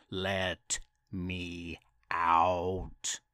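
very slow, low ghostly moan; creepy mummy wail. Acted. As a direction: drag it out from the tomb. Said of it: "It wailed".

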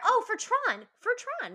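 high, emphatic remembering; excited recall. Real, not acted. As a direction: snap the memory back, pleased.

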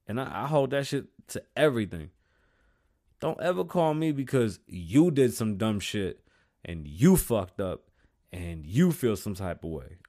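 loud, high fired-up rant; heated rant. Real, not acted. Host ranting at callers about others' mistakes, fired up. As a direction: lay down the law.

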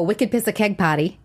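"wicked piss of keg party" without R sounds, Boston style; Boston accent. Shown as a demonstration. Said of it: "Can you say that?".

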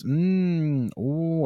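high mock "mm, ooh" of pretend enjoyment; hammed-up fake yum; fake delight. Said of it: "people pretending to, like, really like it".

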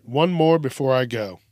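ordinary talk

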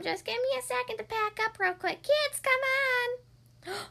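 high, chirpy bird parent; cheerful little bird calling; cartoon bird voice. Bird parent answers and calls kids.

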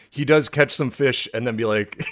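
ordinary delivery

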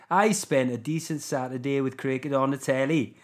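put-on South Wales accent; Nessa from Barry Island. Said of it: "like, Barry Island Nessa".

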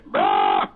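a deep growled rah, dropped far down; imitated low rock growl. Imitating the producer's shout on the record, low growl. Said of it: "that's Garth".

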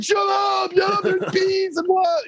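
high-pitched mock furious shout; retold angry yelling. Tennis kid under wasp attack yelling at his laughing friend, mock furious. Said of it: "he gets all mad".

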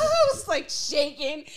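high squealing laugh; laughing shriek. Real, not acted.

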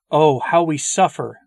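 mournful plea from purgatory; souls crying out. A narrator's reading. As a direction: call out their pain to the living. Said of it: "they cry to us".

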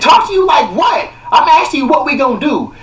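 high, loud indignant yell; angry shout at the head nurse; frustrated shout. Retold or imitated. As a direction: fire it back at the nurse. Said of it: "so I'm getting frustrated".

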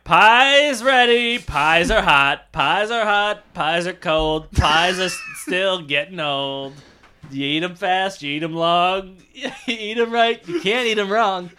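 slow, high-pitched rhyming chant; hammed-up pie-seller's verse; food poem. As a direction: recite it in stretched, sing-song phrases. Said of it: "every food we have comes with a poem".

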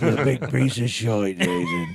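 broad English insult with "shite" as "shoyt"; corrected mock English insult, laughing along; playfully nasty English accent, oy vowels; mean English accent. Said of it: "I believe it's shite".

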